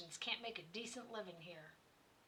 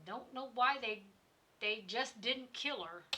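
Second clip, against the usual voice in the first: ordinary talk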